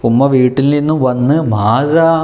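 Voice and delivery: ordinary talk, nothing marked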